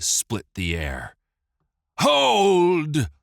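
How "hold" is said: loud, high booming command; booming yell. A narrator's reading. Steward halting the execution with a booming yell. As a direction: stop the execution with one shout. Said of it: "the steward yelled in a booming voice".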